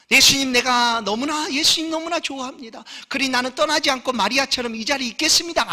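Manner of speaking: high, loud, fervent vow; eager disciple's vow. Acted. A disciple promises Jesus he'll stay, high and fervent. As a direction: declare your devotion, earnest and loud. Voicing disciples' devotion claim.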